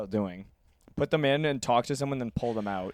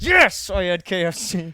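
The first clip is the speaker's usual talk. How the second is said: slow, defiant confession, a bit louder; 4 semitones higher, 6 dB louder than usual. Retold or imitated. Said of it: "And I'm like".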